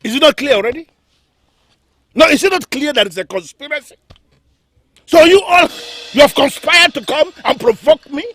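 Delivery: indignant accusation. Acted. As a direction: challenge them, offended. King angrily accuses the council.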